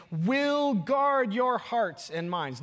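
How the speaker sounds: "will" drawn out high and hard; stress on will. Real, not acted. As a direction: slowly punch "will", weighting each word after it. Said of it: "I love your emphasis on will".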